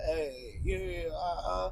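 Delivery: drawn-out, slow rap ad-libs; ad-lib demo. Shown as a demonstration. Rapper showing what ad-libs are, slow drawn-out interjections. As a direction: toss out slow background ad-libs. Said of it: "and then ad libs is".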